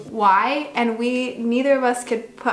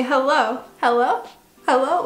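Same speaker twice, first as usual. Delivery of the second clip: higher, louder repeated phone hello; 4 semitones higher, 4 dB louder than usual. Retold or imitated. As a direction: greet the caller again and again. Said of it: "you say".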